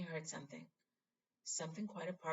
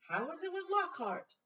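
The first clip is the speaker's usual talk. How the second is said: high, eager Ron; curious Ron; 13 semitones higher, 7 dB louder than usual. Acted. Ron asking Harry about detention. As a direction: ask eagerly.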